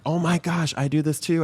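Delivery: lightly acted gushing; imitated excited commenters. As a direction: quote fans lighting up in recognition. Imitating excited commenters recognising themselves.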